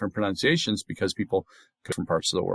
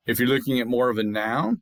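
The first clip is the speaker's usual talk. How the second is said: ordinary talk, nothing marked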